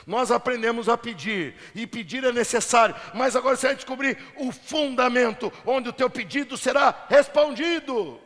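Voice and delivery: high, loud build to a stretched last word; rally the crowd for applause; rousing preacher intonation. Shown as a demonstration. Preacher retrying his point to win applause, rousing intonation. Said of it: "talvez tenha sido a minha entonação. Vamos tentar diferente".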